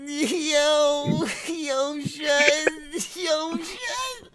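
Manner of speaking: hammed-up New York accent, mock crying. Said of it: "my New York accent".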